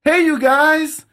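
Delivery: high, loud, drawn-out "Hey, you guys"; mock the singer's corny crowd greeting; cheesy band banter. Mocking the band's singer greeting the crowd, high and cheesy. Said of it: "And then they'll go".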